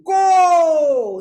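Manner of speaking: high, very loud, long-held "કો"; sound demonstration. Shown as a demonstration. Teacher shows how the syllable is said, long loud "કો". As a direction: stretch the o in "કો" right out. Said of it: "શું બોલાય છે?".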